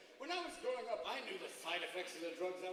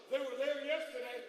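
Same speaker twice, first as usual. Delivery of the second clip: loud, raised mock alarm; panicked dog; 5 semitones higher, 7 dB louder than usual. Dog finding his balls gone, loud mock panic. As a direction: cry out in comic dismay.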